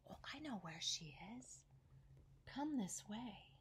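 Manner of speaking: soft whispered lure; sly whisper. Acted. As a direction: whisper it like a sneaky snake. Snake luring the monkey.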